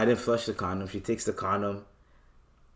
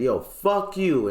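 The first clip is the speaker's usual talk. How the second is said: slow, loud, higher mock curse; angry retold yell; 7 semitones higher, 4 dB louder, slower than usual. To the worker who ignored him, slow loud curse. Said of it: "I fucking said".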